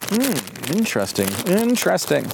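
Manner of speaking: chewing while talking; talking through crunchy chips; crunching chips. Real, not acted. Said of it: "Apologize for the crunching".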